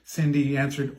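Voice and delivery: ordinary delivery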